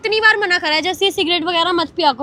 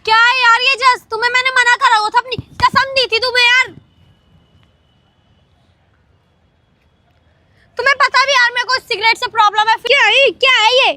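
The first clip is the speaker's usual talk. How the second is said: loud high scolding; angry scolding; 5 semitones higher, 4 dB louder than usual. Real, not acted. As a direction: tear into him for smoking. Girlfriend finds cigarette, scolds.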